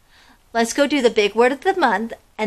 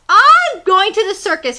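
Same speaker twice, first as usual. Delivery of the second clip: high, loud squawk of a proud rooster; 9 semitones higher, 7 dB louder than usual. Acted. Chicken Chuck storming out of the hen house, loud high squawk. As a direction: crow it out like an indignant chicken. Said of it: "he squawked".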